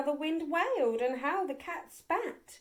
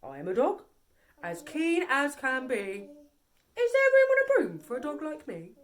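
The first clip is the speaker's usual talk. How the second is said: eager dog; 3 dB louder than usual. A narrator's reading. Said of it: "eagerly said".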